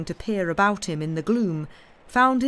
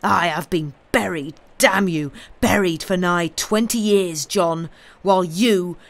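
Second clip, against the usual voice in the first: louder, threatening accusation; menacing; 5 dB louder than usual. Acted. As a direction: spit it at him like a curse. Wronged man confronts his betrayer.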